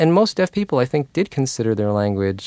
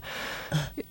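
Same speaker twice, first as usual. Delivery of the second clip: very quiet breath of laughter; hushed real laugh; soft chuckle; 6 semitones higher, 14 dB quieter than usual. Real, not acted. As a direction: chuckle softly at the memory. Amused recalling the club's noises.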